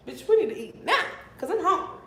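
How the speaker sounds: acted-out bossy complaint; retold demand. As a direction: demand it with playful sass, pitch jumping up. Acting out her demand for food.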